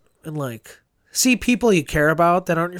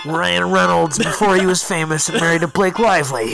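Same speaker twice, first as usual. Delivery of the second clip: movie trailer voice. Acted. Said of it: "You always do the trailer voice".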